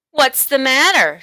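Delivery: bully demanding, loud. A narrator's reading. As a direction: press him hard for an answer. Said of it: "Spider demanded".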